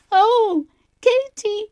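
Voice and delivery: high, loud pained cry; girl with a trapped toe cries out to her sister. Retold or imitated. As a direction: call to her sister, hurting. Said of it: "I cried".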